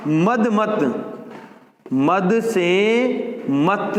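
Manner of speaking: each syllable drawn out slowly; slow teacher dictation. Shown as a demonstration. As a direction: dictate मद से मत word by word. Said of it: "यहां ध्यान रखना".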